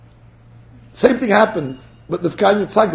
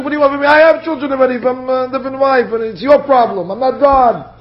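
high-pitched, run-on indignant outburst; exasperated retort; 7 semitones higher than usual. Acted. Yaakov answering his wife who wants children, exasperated retort. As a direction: throw the blame back, voice raised in pitch.

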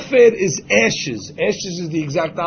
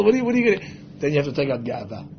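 ordinary delivery

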